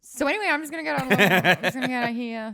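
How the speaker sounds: loud embarrassed laugh-talk. Real, not acted. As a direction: laugh through the words, rushing out.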